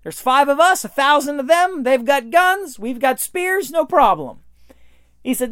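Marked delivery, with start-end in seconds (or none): 0.0-4.4 breezy confidence. Retold or imitated. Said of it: "You just say".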